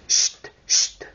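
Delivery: whispered shush; hushing. Acted. Whole party hushing each other before the music, whispered. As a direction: hiss a sharp shush for silence.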